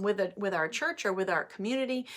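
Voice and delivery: usual speaking voice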